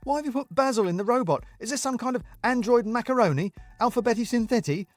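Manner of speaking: high, bemused disbelief; can't believe what he's hearing; incredulous questioning. Acted. Alfie questions Daniel in bemused disbelief.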